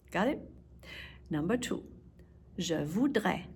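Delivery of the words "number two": usual speaking voice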